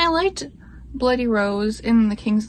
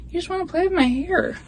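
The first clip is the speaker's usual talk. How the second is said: higher, quick teasing talk to the pet; 8 semitones higher, faster than usual. Real, not acted. Teasing her pet who plays with her hair, higher and quick. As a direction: tease the pet fondly.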